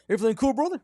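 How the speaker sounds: high, loud mock-casual coach; trying to be cool. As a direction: imitate a coach straining to sound chummy. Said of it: "he's still trying to be cool".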